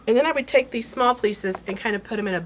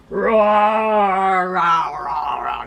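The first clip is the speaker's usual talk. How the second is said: dinosaur roar; 6 dB louder, much slower than usual. Pretend. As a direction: play a roaring dinosaur for fun. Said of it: "Does that sound like a dinosaur?".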